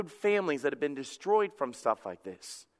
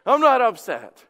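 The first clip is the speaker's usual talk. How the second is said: loud, higher-pitched indignant denial; defensive denial; 4 semitones higher, 8 dB louder than usual. Acted. Upset spouse answering the question, loudly defensive. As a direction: shout it back, clearly upset while denying it. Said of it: "you know how they do".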